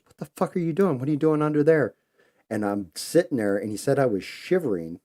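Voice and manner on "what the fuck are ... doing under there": high, loud startled shouting; shocked yell. Retold or imitated. Buddy finds him under the bed, shouts in shock. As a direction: yell it like you just found your friend hiding under the bed. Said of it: "looked under the bed and goes".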